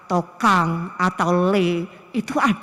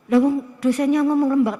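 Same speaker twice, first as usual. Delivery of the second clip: normal talking voice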